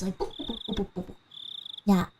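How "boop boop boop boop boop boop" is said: soft boops hopping up and down. Shown as a demonstration. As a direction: sketch a bouncy tune in quiet boops. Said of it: "Like, up and down voices".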